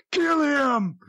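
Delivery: very high, drawn mock yell; retold scream. Bloodthirsty friend yelling down at the wrestler, retold scream. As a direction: imitate a wild fan's scream without full volume. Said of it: "Danny screams at him again".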